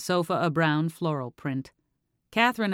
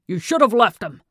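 much louder and higher furious shout; angry shout; 5 semitones higher, 10 dB louder than usual. A narrator's reading. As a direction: shout it at the daughter in rage. Father's angry outburst at daughter.

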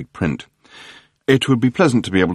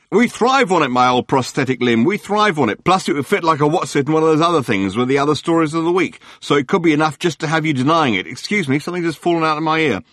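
gushing tabloid hack; 8 semitones higher than usual. Acted. Journalist Murray greeting Arthur on the phone, gushing. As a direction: lay the flattery on thick. Murray voiced, high and chatty.

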